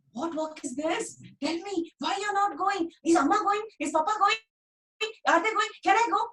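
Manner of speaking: put-on high, pleading child's voice; eager little boy. Dhani pestering his busy mother about the meeting. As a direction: tug at mother's sleeve with your voice. Boy peppering gardener with questions.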